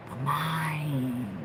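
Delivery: a soft whisper of the words. Acted. Reader whispers the key word to viewers. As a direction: whisper it like a secret.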